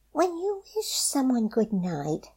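high, small bunny voice; a careful little rabbit. A narrator's reading.

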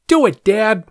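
put-on high, goading child voice; bratty kid. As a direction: egg on dad like a brat. Bratty kid egging on dad.

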